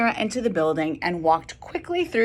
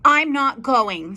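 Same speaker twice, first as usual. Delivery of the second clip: put-on sulky child; 6 semitones higher, slower than usual. Child refusing, pulling covers.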